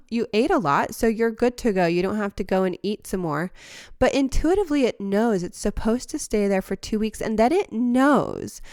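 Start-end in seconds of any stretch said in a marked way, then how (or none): 4.0-4.8 "intuitively" lifted higher with a slight push; stress on intuitively. Real, not acted. Speaker to listeners, lightly stressing "intuitively". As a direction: lean on "intuitively" to set it apart. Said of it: "I want to emphasize the word intuitively".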